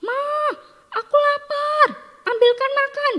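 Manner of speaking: put-on very high, loud bossy kid shout; child yelling demands. Child home from school demanding food from mum, high acted yell. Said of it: "langsung teriak".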